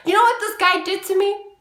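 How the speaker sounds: high loud accusing cry to the group; loud indignant call-out. Retold or imitated. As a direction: call him out to everyone. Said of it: "I'm just like".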